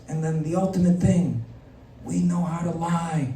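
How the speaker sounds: a hint of a mob boss lecturing; lightly voiced godfather Trump teaching politics; mock godfather Trump. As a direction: teach the kid like a mafia don. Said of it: "Don Corleone Trump".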